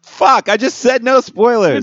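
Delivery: mock outrage. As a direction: shout it like betrayed. Spoiler outrage.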